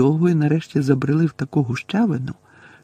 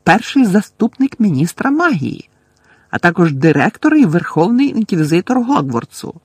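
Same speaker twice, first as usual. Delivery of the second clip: shrill frightened boasting; 5 semitones higher, 5 dB louder than usual. A narrator's reading. Umbridge keeps shrilly asserting.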